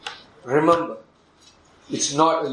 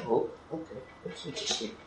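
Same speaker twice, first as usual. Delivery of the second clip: hushed aside; 2 semitones lower, 14 dB quieter, faster than usual. Retold or imitated. Pastor retells his reply to girl.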